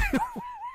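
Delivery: real laughing shout. Real, not acted. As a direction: explode into laughter. Laughing at Gatsby's memory.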